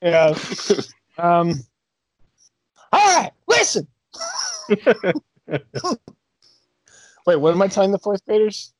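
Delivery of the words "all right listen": Kevin Hart sleep talking. Retold or imitated. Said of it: "Kevin Hart talking in his sleep".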